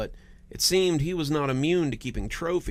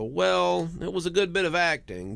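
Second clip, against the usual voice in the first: dismissive police; 6 semitones higher than usual. Retold or imitated.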